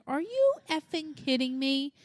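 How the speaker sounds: high-pitched retold incredulity; mock-incredulous outburst; exasperated disbelief. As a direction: act out a parent at wit's end, pitched high. Retelling disbelief at son's new demand.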